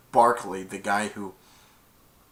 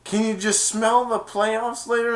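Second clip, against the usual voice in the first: eager; 10 semitones higher, 4 dB louder than usual. Retold or imitated. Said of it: "he's like".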